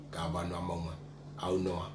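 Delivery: normal talking voice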